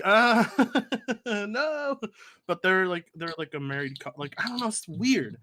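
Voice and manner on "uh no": high, laughing no; laughing refusal. Retold or imitated. Guy retelling turning down a couple, laughing and high. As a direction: laugh the no out, pitched up. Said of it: "I was like".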